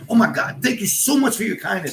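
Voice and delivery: high, sugary mock gratitude; sarcastic thanks. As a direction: gush thanks you clearly don't mean. Sarcastic thanks, confirmed by listener.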